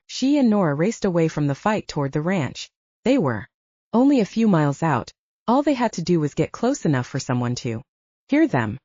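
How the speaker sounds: ordinary delivery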